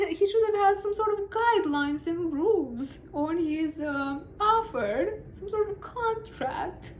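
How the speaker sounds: mock the king through laughter; sarcastic. Real, not acted. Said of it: "I'm being sarcastic".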